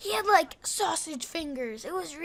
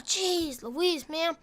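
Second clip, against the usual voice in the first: normal talking voice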